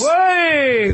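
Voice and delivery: high, drawn-out fight-corner shout; corner yell. Retold or imitated. Training partner imitating fight corners cheering kicks, high shout. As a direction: shout it long like a cornerman at a kick. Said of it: "their corner will go".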